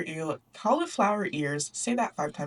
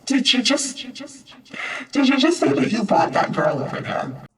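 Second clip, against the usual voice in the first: much louder, put-on indignant shout; outraged soldier; 5 semitones higher, 7 dB louder than usual. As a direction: bark at him in disbelief. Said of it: "The soldier hears him and says".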